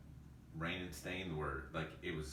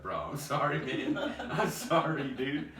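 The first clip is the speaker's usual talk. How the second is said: laughing apology; 10 semitones higher, 8 dB louder than usual. Retold or imitated. Friend apologising while laughing.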